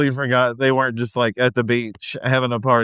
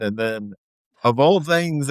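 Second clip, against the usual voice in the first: ordinary talk, nothing marked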